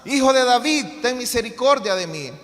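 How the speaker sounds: a blind beggar shouting over those who hush him. A narrator's reading. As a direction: shout it for mercy over the crowd. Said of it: "él clamaba mucho más".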